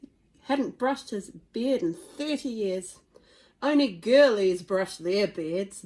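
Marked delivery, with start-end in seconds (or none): 3.6-5.8 loud, slow booming Viking father. Acted. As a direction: boom it out like a huge Viking chief. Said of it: "boomed Stoic the Vast".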